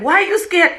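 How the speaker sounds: high-pitched exasperated challenge; playful imitation of scolding; mock badgering husband. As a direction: demand it, hammed-up and indignant.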